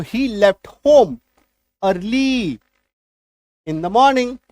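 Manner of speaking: English sentence said very slowly; slow dictation for students; slow model sentence. Shown as a demonstration. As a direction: dictate it word by word. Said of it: "time से पहले".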